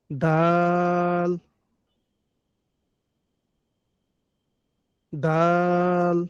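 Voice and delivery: দাল stretched very slowly, tongue tip on the upper gum line; slow teacher drill of Arabic dal; repeated dal demo. Shown as a demonstration. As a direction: repeat দাল slowly, each time held long. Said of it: "হুম, বলুন".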